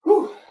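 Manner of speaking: loud high exhale after the set; effort grunt. Real, not acted. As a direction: blow out hard after the lift. Finished set, blowing out.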